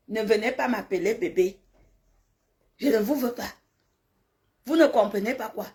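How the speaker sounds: ordinary talk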